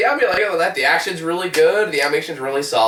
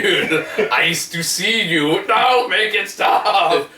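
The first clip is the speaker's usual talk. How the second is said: laughing plea; 5 semitones higher, much slower than usual. Real, not acted. Pleading through laughter.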